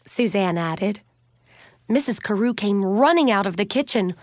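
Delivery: plain reading voice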